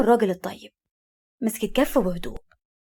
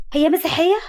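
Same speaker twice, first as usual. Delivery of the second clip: high, loud blurted question; hasty blurt; 8 semitones higher, 5 dB louder than usual. A narrator's reading. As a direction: blurt it out without thinking. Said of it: "أمينة بتسرع".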